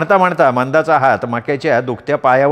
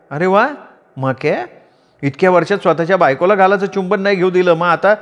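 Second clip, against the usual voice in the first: higher, exasperated wife voice; scolding wife; 5 semitones higher than usual. Acted. Wife Manda scolding her clumsy husband, higher voice.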